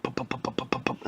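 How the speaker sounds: soft rapid popping with the lips; imitated gunfire. Old lady firing umbrella gun, soft popped gunfire noises. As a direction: rattle off quiet popping gun sounds. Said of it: "she was like".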